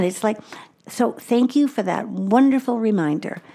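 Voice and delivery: ordinary talk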